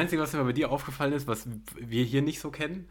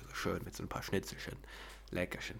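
soft, muttered Kölsch accent, lightly put on; hint of a cosy Cologne dialect, half under the breath; 3 semitones lower, 11 dB quieter than usual. Shown as a demonstration. Showing a friend the one dialect he can do, in Kölsch accent. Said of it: "am besten noch das Kölsche".

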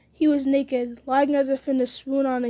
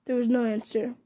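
usual speaking voice

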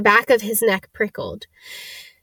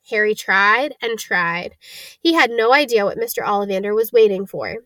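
usual speaking voice

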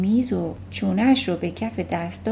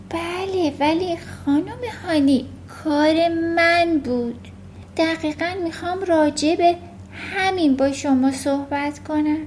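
put-on lightly raised little-girl voice, earnest; a young girl owning up, higher; Matilda's voice; 6 semitones higher than usual. A narrator's reading. Matilda admits to her teacher, lightly voiced girl. Matilda confesses to Miss Honey.

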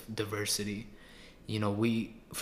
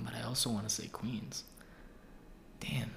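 ordinary talk, nothing marked

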